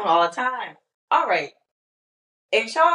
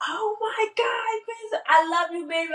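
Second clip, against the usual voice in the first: gushing mom; 11 semitones higher than usual. Retold or imitated. Mom gushing over [name].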